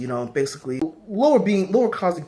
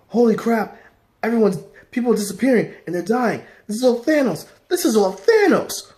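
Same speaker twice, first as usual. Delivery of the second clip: high, frantic outburst getting louder; acted panic; 8 semitones higher, 4 dB louder than usual. Silver Surfer warning everyone is dying, acted panic. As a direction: raise the alarm, hammering the repeated accusation.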